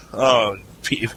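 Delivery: ordinary delivery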